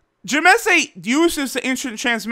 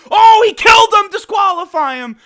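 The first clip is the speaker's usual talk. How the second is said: high, loud shout of protest; outraged shout; 11 semitones higher, 8 dB louder than usual. Retold or imitated. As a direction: yell it like angry referees. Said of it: "Universe two gods are like".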